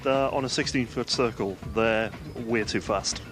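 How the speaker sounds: ordinary delivery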